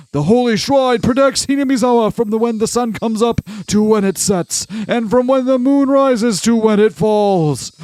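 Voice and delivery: put-on high, intense, ranting old man. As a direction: rant like a furious old elder. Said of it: "I'm doing an old man voice, but very intense".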